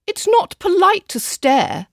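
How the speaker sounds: high, slow chiding schoolmarm tone. A narrator's reading. Aggie scolds Verdi for staring, slow high chiding. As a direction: scold the staring friend, slowly. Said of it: "chided Aggie".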